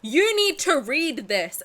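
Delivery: slow, loud, forceful order; mock aggressive demand. School bully forcing a book on a shy kid, slow forceful demand. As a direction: push the book on her like a bully. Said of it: "she aggressively grabbed me and was like".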